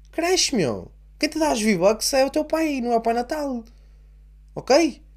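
put-on high-pitched blunt lecture to a ten-year-old; exasperated dad. Father telling his ten-year-old son to grow up, exasperated high lecture. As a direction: tell him to grow up, fed up. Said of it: "ter um filho e ter que lhe fazer isto".

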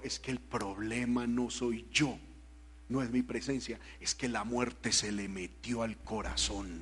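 low, soft, slow divine voice; voice of God. Retold or imitated. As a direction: speak God's words gently and deep. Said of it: "Y el Señor me dijo". (voz de Dios, grave, suave y lenta)

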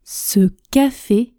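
slow, deliberate model sentence; tortoise speed. Shown as a demonstration. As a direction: stretch it out for learners to follow. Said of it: "Répétez avec moi à la vitesse tortue". (vitesse tortue, lent et bien articulé)

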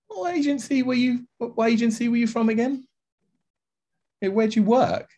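high, puzzled questions to a stranger; curious quizzing. Retold or imitated. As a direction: quiz him in high bemused disbelief.

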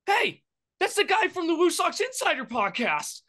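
high-pitched fan spotting a local celebrity; imitating a fan. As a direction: squeal it like a starstruck fan. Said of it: "a fan stops and goes".

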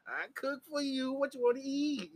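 high, coaxing offer to a lover; doting sweet-talk. Pretend. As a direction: coo it up high, playing the doting partner. Playing doting partner to daddy.

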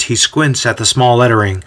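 usual speaking voice

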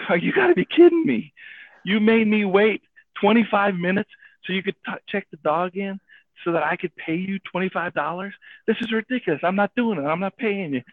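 indignant retold protest, pitched high; mad. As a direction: argue back in outraged disbelief, voice riding high. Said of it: "I'm starting to get really mad".

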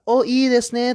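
put-on high, bright-eyed praise for a child's effort; high, cooing encouragement for a child; encouraging praise. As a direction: cheer on a kid's clumsy drawing, up high. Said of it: "って言っちゃうんですね".

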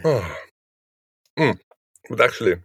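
eating chocolate while talking; mouth full. Real, not acted. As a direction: talk through a mouthful of chocolate. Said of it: "sorry, I'm eating chocolate".

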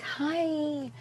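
high, soft, just-woken hello; sleepy hi. Retold or imitated. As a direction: mimic a groggy friend answering the phone. Friend woken from a nap.

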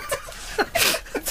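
high, sneering little laugh; mock snooty laugh. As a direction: scoff like a snob. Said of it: "Это такой".

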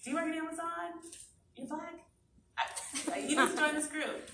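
high-pitched, urgent excited stranger imitation; playful high-voiced recruiter impression; eager stranger. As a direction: imitate someone rushing up breathless with excitement. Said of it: "a woman running out of the back of a restaurant".

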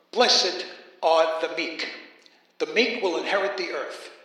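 Blessed are the meek, slow and weighty like scripture; scripture recitation inside a Brooklyn accent; solemn quoting. Acted. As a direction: intone it like the verse. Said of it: "Let's begin".

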